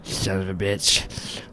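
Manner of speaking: slow, put-on groaning curse; mildly hammed-up creature frustration; frustrated creature. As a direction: curse slowly after a failed attack. Creature curses after weapon shatters.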